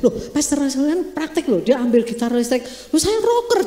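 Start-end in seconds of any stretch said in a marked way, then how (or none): 2.9-3.7 playful rocker boast. Retold or imitated. Pastor shows off as rocker.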